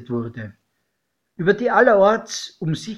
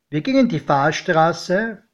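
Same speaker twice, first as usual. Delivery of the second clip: plain reading voice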